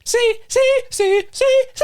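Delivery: put-on high, chirpy Spanish-accented sing-song; mock Spanish. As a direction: bounce it like a Spanish folk chant.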